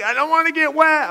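high-pitched imitation of a complaint; mock whining girl. Girl under a towel to driver, mock high whine. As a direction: whine it like a reluctant kid. Girl refusing to help bail.

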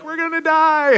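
mock panic, voice jumping high; panicked kids. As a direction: squeal it like scared teens. Kids panicking on speeding boat.

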